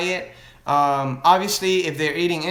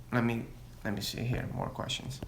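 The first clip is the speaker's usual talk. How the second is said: muttering to himself; 4 semitones lower, 13 dB quieter than usual. Real, not acted. Reading questions to himself.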